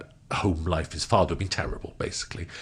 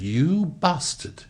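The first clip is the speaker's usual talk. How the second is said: retold scolding; 7 semitones higher than usual. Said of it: "The lads all turned around and said".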